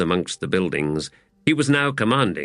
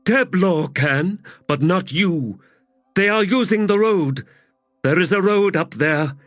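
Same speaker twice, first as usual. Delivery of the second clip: high, booming giant woman; giant warrior woman; 10 semitones higher, 3 dB louder than usual. A narrator's reading. Teblor woman's voice.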